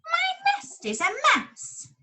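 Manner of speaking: a squawking little bird begging for help; small bird. Acted. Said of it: "A bird was squawking".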